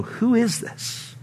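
soft, slow, hushed amazement; awed whisper. Retold or imitated. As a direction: murmur it in quiet awe. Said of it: "They looked at one another and they go".

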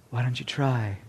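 soft, low, unbothered challenge; imitated calm. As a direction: dare them very quietly and calmly. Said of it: "Nice, all calm".

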